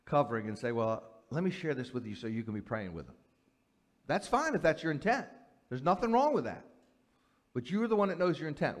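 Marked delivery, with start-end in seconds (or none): 0.7-3.2 low, soft, mock-pious confiding; sanctimonious. Gossip posing as a prayer request, quietly sanctimonious. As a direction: play gossip passed off softly as concern. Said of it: "cover it with a sanctimonious covering".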